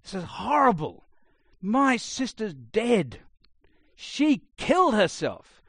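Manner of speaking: loud, slow, raw outburst of grief; furious brother. Retold or imitated. Brother on stage bursts out at sister's funeral, furious shout. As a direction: spit out the anger at the funeral. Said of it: "was furious and said".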